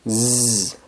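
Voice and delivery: z held as a soft, voiced "zzz"; buzzing Z sound. Shown as a demonstration. Teacher isolates the liaison sound, z as a buzz. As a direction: hum a long z on its own. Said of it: "es un sonido".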